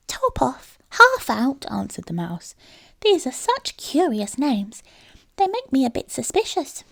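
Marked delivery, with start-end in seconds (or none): 0.1-1.6 high, wondering mouse voice, louder; a mouse who has never heard such a name; puzzled little mouse. A narrator's reading. Mouse lists the names. 3.0-6.8 a mouse starting to smell a rat; suspicious little mouse. A narrator's reading.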